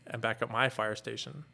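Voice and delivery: normal talking voice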